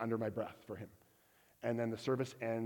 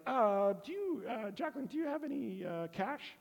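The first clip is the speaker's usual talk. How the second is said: hesitant ask; 11 semitones higher than usual. Retold or imitated. Husband awkwardly asks his wife for cash, hesitant. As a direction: feel your way into an awkward question, pitched high.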